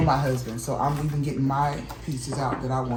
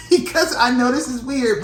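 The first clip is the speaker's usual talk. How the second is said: laughing; 8 semitones higher, 4 dB louder than usual. Real, not acted. Laughs mid-line.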